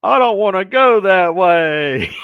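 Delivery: loud, high, drawn-out mock wail; hammed-up howl of refusal; horrified inner protest. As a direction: wail it in comic horror. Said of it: "and I'm going".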